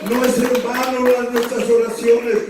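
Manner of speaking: full-force preaching. Acted. Pastor urging the congregation, full preaching voice. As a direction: preach it out to the whole room.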